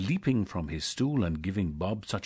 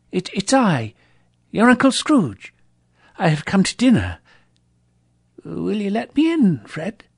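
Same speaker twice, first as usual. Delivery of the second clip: high, hesitant, stammering plea; a shy old man asking to be let in; nervous old Scrooge; 12 semitones higher, 9 dB louder than usual. Acted. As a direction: Scrooge begging timidly to join dinner. Scrooge nervously announcing himself.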